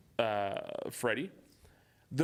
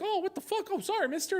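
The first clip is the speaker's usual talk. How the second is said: put-on startled kids. Kids bumping into Michael Myers.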